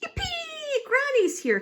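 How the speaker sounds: very high, thrilled squeal; yippee. A narrator's reading. Daisy greeting Granny, thrilled and squealing. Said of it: "cried Daisy".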